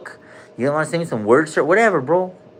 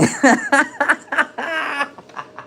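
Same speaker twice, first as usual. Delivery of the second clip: high laugh; 12 semitones higher than usual. Real, not acted. Laughs at own joke.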